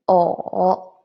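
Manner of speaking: O dipping, then up; third tone. Shown as a demonstration. Teacher drilling second line, o dipping. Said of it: "And then the second line".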